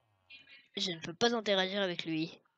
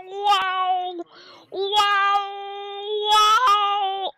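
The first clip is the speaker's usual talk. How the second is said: mock scared shrieks; 11 semitones higher, 9 dB louder, much slower than usual. Shrieks at scary zombies.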